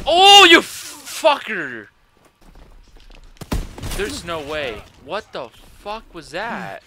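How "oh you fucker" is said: anguished cry. Real, not acted. Killed, cries out.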